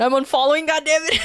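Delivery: playful exasperated outburst; mock outrage. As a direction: pretend to be fed up, about to crack up. Mock outrage at dad joke.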